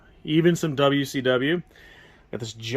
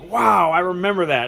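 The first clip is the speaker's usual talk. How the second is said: louder, higher imitated wow of recognition; amazed visitor reaction; 5 semitones higher, 5 dB louder than usual. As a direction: mimic customers amazed by a memory.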